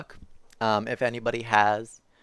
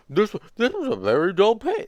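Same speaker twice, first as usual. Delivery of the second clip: a higher puppet voice that misses; a hint of a Muppet, higher; first try at Grover; 9 semitones higher, 4 dB louder than usual. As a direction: aim for Grover and miss. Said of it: "that's not even close".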